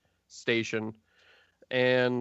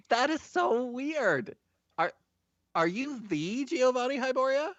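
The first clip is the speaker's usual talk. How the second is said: starstruck admin; 12 semitones higher than usual. Acted. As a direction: gush at meeting someone famous. Starstruck desk admin meets Giovanni.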